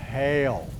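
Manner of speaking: usual speaking voice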